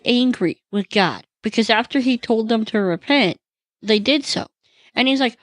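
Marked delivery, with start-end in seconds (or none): none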